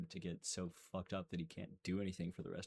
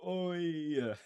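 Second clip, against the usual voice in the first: sharp higher "Oy" of pain; mock pain cry; 9 semitones higher, 5 dB louder than usual. Mocking how French fighters cry out. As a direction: yelp it like a hurt Frenchman. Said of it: "what French people do when they're in pain".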